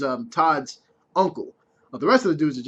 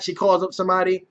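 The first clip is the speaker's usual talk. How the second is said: ordinary talk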